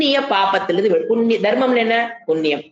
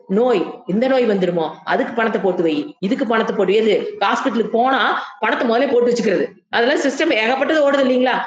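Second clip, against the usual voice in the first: ordinary delivery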